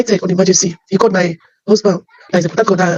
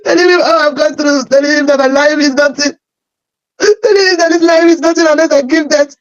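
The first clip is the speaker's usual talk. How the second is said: higher, tearful voice breaking into sobs; wife crying out her troubles to grandfather, tearful and raised; 7 semitones higher than usual. Retold or imitated. Pour out the pain through tears. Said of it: "I started pointing and crying".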